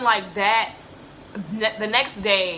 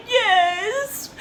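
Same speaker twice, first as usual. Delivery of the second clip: high, wailed reply; hammed-up tearful squeal; mock-crying answer; 14 semitones higher, 6 dB louder than usual. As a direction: imitate answering through sobs. Retells answering mom while crying.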